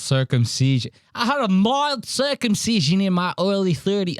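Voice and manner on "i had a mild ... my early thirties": higher-pitched mock Australian voice; attempted Australian accent. Comic mimics a man confessing circumcision, attempted Australian accent. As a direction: put on a rough attempt at Australian. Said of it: "I can't do my Australian right now".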